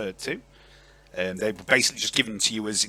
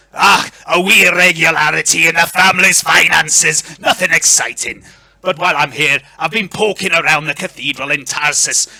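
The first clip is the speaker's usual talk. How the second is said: loud, hearty Scottish lord; broad Scottish accent; 5 semitones higher, 9 dB louder than usual. Acted. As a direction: speak up in a Scottish burr, pitched higher. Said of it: "I apologise in advance for this accent".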